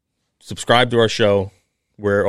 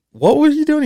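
mock-shocked squeal, much higher and louder; a mother suddenly alarmed, in a high retold squeak; imitating a startled mom; 5 dB louder than usual. Wife's shocked question in story.

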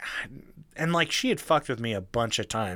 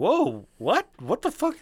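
high, rising startled questions; retold surprise; 9 semitones higher than usual. Taken aback by his girlfriend, high mock-startled reply. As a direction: pitch leaps up in mock alarm.

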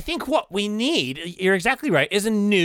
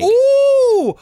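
excited ooh; 8 dB louder than usual. Real, not acted. Reacting to keynote.

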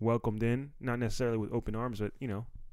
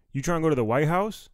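friend's loud excited invite, voice jumping up; friend's invite, rising; 6 semitones higher, 8 dB louder than usual. Retold or imitated. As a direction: toss it out like a buddy pitching a wild plan. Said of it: "and he was like".

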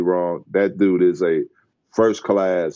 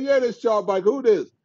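lightly voiced higher Barkley; casual higher impression of Barkley; Charles Barkley voice; 13 semitones higher than usual. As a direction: toss it off casually as the star. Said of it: "He said".

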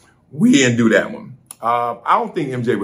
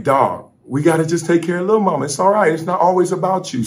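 quick, higher and brighter scolding; lively retold pep talk; 7 semitones higher, faster than usual. Dad telling his bored son to look after his little sister, quick and high. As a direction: hype him up while calming him down. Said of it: "I'm like".

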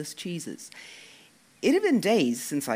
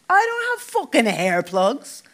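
angry denial; 8 semitones higher, 8 dB louder, slower than usual. Acted. Dec furious denial.

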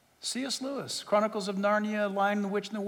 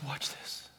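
soft whispered aside from a crying daughter; hushed tearful-daughter whisper; retold whisper; 6 semitones lower, 11 dB quieter than usual. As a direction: whisper it as if choked up. Daughter crying, shows phone.

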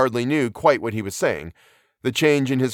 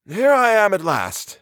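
put-on old professor exclaiming, high and slow; high, drawn-out, tired greeting; weary exclamation; 9 semitones higher, 4 dB louder, slower than usual. A narrator's reading. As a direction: exclaim it somewhat wearily. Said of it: "exclaimed the professor, somewhat wearily".

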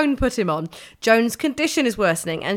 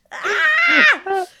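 shout; 6 dB louder than usual. Real, not acted. Sudden shout.

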